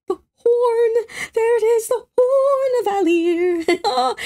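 high, drawn-out singsong awe; giddy high-pitched fangirl reveal; excited gushing. Real, not acted. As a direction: sing out the reveal, stretching the words. Gushing as she spots the horn.

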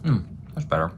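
speaking between bites; muffled by food in mouth; talking while chewing. Real, not acted. Seen 0: fork to mouth at 0.3, closed-mouth chewing through 6.6.

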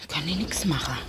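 resigned whisper to herself. Real, not acted. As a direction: breathe it out, giving up. Leise resigniert zu sich. (resigniert geflüstert)

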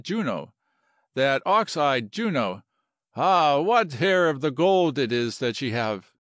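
philosophizing Latin general. Acted. General explains choosing hotels and Juno over war, lyrical. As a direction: wax lyrical as a smitten officer.

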